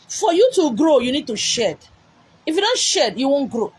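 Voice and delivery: fervent shouted refrain; loud preaching refrain. Acted. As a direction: ring it out to the crowd.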